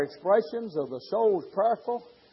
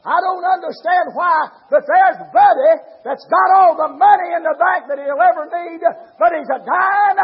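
shouted sermon, building higher and louder; fiery preaching; 7 semitones higher, 11 dB louder than usual. Acted. Preacher thundering at his congregation, full-force preaching.

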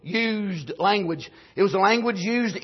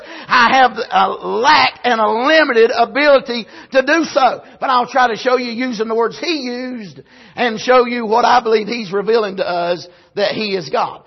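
ordinary talk

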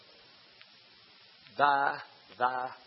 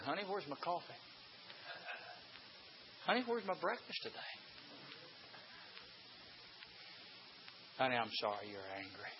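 coaxing husband; 14 dB quieter than usual. Retold or imitated. Husband coaxing wife next morning.

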